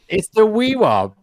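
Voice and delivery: wewop said wee-wop, high and loud; saying wewop for the friend; tom's attempt. Shown as a demonstration. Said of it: "I said it".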